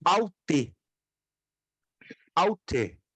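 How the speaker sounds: "aute" as OW-te, open diphthong first; teaching pronunciation. Shown as a demonstration. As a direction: model "aute" carefully in two syllables. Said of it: "E a pronúncia é".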